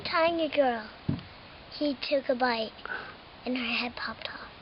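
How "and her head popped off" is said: whisper. Acted. Gory twist in kids' story.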